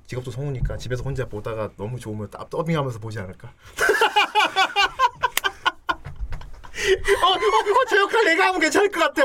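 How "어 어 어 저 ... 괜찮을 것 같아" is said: laughing. Retold or imitated. Mock voice actor dubbing at home.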